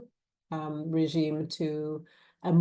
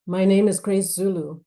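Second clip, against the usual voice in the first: firm, louder self-introduction; controlled anger; 2 semitones higher, 7 dB louder than usual. A narrator's reading. Lawyer introducing herself to her client, controlled anger. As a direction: hold the anger in, speak up firmly. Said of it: "tried to control her rising anger".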